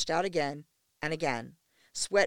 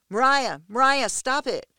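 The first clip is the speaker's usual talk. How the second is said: loud urgent shout; 7 semitones higher, 10 dB louder than usual. A narrator's reading. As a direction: shout her name to stop her. Shouting to stop fight.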